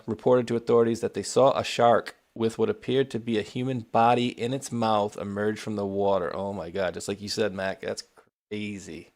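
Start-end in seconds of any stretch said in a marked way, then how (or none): none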